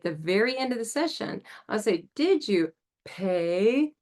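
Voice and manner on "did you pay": very slow, stretched call; leading a call and response in therapy. Shown as a demonstration. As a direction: call it out slowly to the group. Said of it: "call and response".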